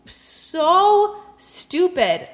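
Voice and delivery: high, dragged-out disbelief; incredulous scorn. Retold or imitated. Telling friends how they answered a coworker, incredulous scorn.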